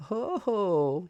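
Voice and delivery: high, loud sneer; a mocking high sneer. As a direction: sneer it at the boy. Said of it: "he sneered".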